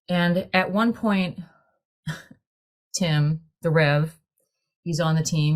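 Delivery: ordinary delivery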